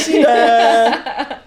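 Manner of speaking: high, loud and quick; teasing hype. Shown as a demonstration. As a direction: tease the big surprise. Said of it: "I'm like".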